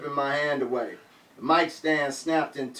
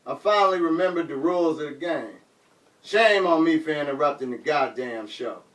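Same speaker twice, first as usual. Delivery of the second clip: usual speaking voice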